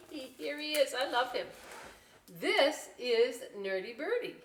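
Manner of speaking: normal talking voice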